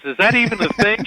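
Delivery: high, fast, amazed question; mock disbelief. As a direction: sound floored by what you just heard. Audience member reacting in disbelief.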